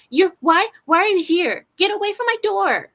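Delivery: high, loud hysterical yelling; mock shrieking neighbour. Neighbour yelling through the door, high hysterical shriek. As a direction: play her shrieking at the door.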